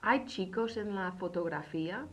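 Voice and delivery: "¿Hay chicos en la fotografía?" rising at the end; question intonation. Shown as a demonstration. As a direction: lift "fotografía" into a yes-no question. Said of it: "but say it as a question".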